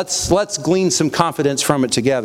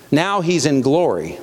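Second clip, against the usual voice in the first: usual speaking voice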